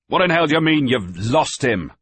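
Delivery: loud, furious demand, slowing at the end; angry shout. A narrator's reading. Master hearing a man is lost, angry shout. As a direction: bark the question in disbelief.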